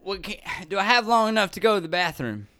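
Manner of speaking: put-on drunk Southerner. Drunk passenger needing a bathroom, Southern drunk voice. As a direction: ask it like a drunk with a Southern accent. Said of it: "stuck in the Southern accent".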